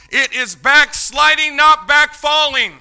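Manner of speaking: slow, high, loud declaration; shouted point. Real, not acted. Preacher telling folks it is backsliding, shouted point. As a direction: drive each word home at full voice. Preacher drives contrast home.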